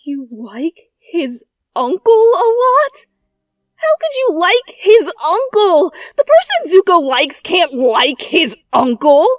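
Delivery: high, loud disbelief; flustered teen outraged; incredulous. Acted. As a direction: shriek it in disbelief. Zuko incredulous at the confession.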